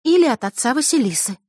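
put-on high-pitched girl's voice; suggesting girl. A narrator's reading. Diana offers her guess to friends, high suggesting voice. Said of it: "предположила Диана".

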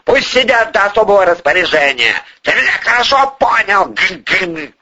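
high-pitched, angry order; heated, high-pitched command; flaring temper. A narrator's reading. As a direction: lay down the law hotly. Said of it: "вновь вспылил Порешайло".

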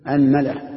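أنملة as "anmala", a on hamza and meem; a then a. Shown as a demonstration. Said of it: "افتحوا مع فتح ال".

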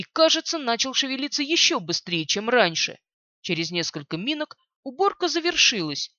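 usual speaking voice

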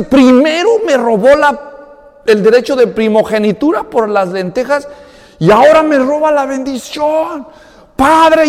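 high, loud complaint of a cheated son; raging lament. Retold or imitated. Cheated son complaining to his father, high and loud. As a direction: pour out the grievance in rage. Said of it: "empieza a llorar de rabia".

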